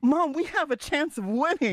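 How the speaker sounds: one last desperate try; Rita pleading. Acted. Daughter begging her mother about winning, high and urgent. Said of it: "I'm Rita".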